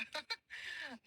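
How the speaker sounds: soft little laugh; amused chuckle. Real, not acted. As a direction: chuckle quietly at the mistakes. Amused at the students' muddled spellings. (धीमी हँसी)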